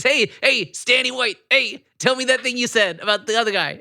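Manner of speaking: high, hammed-up New York wiseguy accent.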